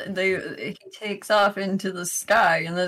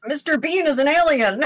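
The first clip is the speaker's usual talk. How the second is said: higher, imitated excited text message; mock excited announcement; 7 semitones higher, 4 dB louder than usual. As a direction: blurt it like breaking news. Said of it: "you just get this text message".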